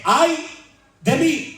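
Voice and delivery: high anguished prophet's cry. Acted. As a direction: cry out as a doomed man. Preacher voicing Isaiah's cry.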